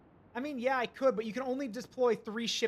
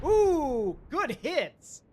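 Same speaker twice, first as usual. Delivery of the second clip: excited; 7 semitones higher, 9 dB louder, much slower than usual. Real, not acted. Shells land on target.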